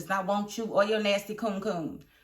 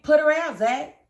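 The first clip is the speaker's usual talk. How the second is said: louder, higher call to put her out; urging; 6 semitones higher, 5 dB louder than usual. Real, not acted. Telling Zach to evict her, loud urging. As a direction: egg him on, loud.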